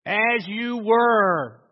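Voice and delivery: slow, drawn-out drill order; stretched parade-ground call; military command. Acted. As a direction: call it out to the troops, stretching each word.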